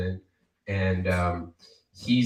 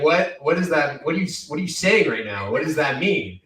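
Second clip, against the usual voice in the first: higher, louder confused questioning; baffled reaction; 6 semitones higher, 5 dB louder than usual. Retold or imitated. Barista baffled by boss blaming Mercury retrograde. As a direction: react like you can't believe what you heard. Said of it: "And I'm just like".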